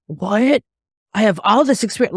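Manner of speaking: lightly voiced outrage from a rejected student; indignant wannabe. Rejected student protesting to the teacher, lightly mocking indignation. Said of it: "rejected students protest".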